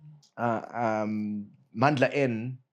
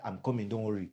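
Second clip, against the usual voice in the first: put-on softer, understated Nigerian accent; 6 dB quieter than usual. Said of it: "bring that Nigerian accent".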